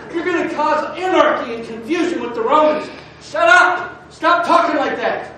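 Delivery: loud, high scolding order; angry religious leaders. Acted. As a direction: lay down the law, building to a shout. Acts religious leaders scolding the apostles.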